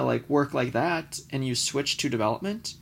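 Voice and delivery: ordinary talk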